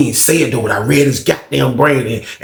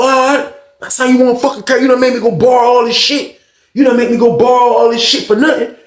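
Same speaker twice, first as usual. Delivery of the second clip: heated complaint; 11 semitones higher than usual. Retold or imitated. Fred scolding the old head, high and heated. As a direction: let him have it, betrayed.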